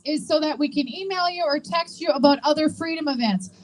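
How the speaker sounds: ordinary talk, nothing marked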